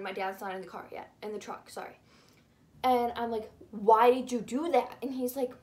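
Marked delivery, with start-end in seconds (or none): 3.7-5.0 demanding. Retold or imitated. Confronting brother in the truck.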